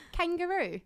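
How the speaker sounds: put-on playful high, loud British accent. Co-host joins the British-accent joke, British accent. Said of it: "say it with a British accent".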